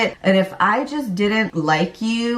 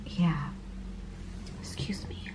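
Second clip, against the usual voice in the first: whispered through tears; fighting back tears, barely voiced; choked up; 15 dB quieter than usual. Real, not acted. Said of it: "it makes me emotional".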